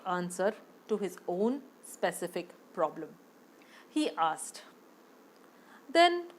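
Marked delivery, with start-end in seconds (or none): 5.9-6.3 pleading higher, near tears; a rich man begging the guru to save him; desperate sinner. Acted. Businessman begging Gurudev for salvation, higher pleading voice.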